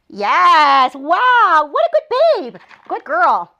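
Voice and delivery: high, loud, gushing praise for a puppy; excited puppy praise. Real, not acted. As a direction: cheer the puppy on like she just did something amazing.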